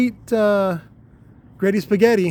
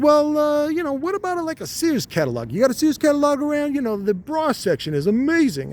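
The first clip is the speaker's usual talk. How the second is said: much higher squeaky voice, suggestive; a little pest pitching an idea in a high voice; talking testicle; 11 semitones higher than usual. Acted. A high-voiced testicle suggesting the Sears catalog. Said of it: "He's like".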